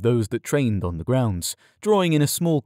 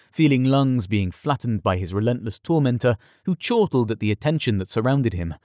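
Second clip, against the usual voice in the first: usual speaking voice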